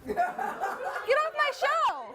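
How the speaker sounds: high, loud, drawn-out angry shout; yelling. Acted. Host throwing a guest off the show, yelling. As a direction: yell it with each word stretched, pitch soaring. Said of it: "Don't yell at me".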